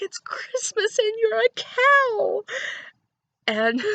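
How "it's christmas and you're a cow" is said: high, loud squeal of delight; overjoyed squeal at a cow; bursting with joy. Retold or imitated. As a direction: shriek it out like a kid at Christmas. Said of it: "I just erupted in joy and was like".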